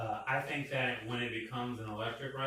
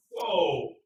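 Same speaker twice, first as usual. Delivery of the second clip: loud, high startled whoa; a big mock gasp of surprise; surprised whoa; 6 semitones higher, 9 dB louder than usual. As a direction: react in shock at the sound. Reacting to the weak horn.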